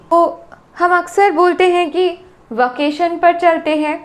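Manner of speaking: usual speaking voice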